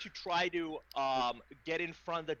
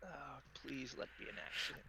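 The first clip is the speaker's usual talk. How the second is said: whisper; 13 dB quieter, faster than usual. Real, not acted. Hoping the deception roll works.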